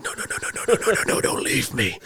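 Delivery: urgent whisper, no-no-no in a rush; whispered plea. Retold or imitated. Hunter to his departing friend, don't leave me here, whispered pleading. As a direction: plead under your breath, retelling your panic.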